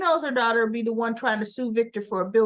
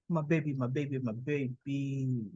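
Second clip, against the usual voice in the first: doting husband; 8 semitones lower, 6 dB quieter than usual. Retold or imitated. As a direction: coo it like a besotted old husband. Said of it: "Oh, him and the my babies".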